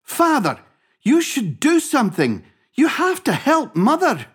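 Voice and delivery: high, urgent appeal to a father; pleading boy. Acted. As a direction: plead up high like an upset son.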